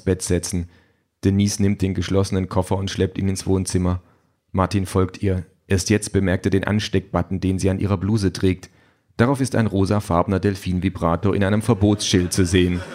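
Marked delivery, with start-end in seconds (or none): none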